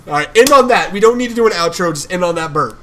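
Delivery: high, loud, excited call; loud amusement. Real, not acted. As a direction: cut in loudly to wrap it up. Joking sign-off after a burp.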